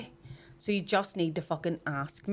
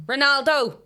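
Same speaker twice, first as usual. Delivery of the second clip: loud, higher-pitched calling out; calling her kid; 5 semitones higher, 6 dB louder than usual. Retold or imitated. Woman in the bank imitated calling her kid, hollering. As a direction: holler for a child across the room. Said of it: "this woman started calling her kid".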